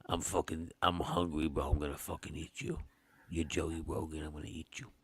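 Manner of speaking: low, gruff hungry-deer voice; Joey Diaz. Pretend. Deer voiced as Joey Diaz, threatening to eat his friend, low and gruff. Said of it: "It's Joey Diaz".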